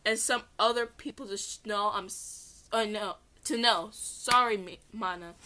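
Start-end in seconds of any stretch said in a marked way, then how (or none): none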